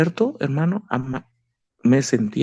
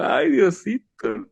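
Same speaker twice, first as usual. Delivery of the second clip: frightened plea; 8 semitones higher, much slower than usual. Retold or imitated. Frightened as panthers released.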